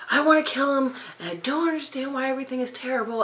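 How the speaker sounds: high-pitched, hurried retelling of despair; mock distraught rant. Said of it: "I'm crying, and he's yelling".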